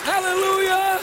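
high-pitched, full-force sermon delivery; impassioned preaching. Real, not acted.

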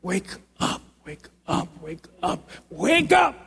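urgent shout. Real, not acted. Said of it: "repeated urging".

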